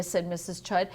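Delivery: ordinary delivery, nothing marked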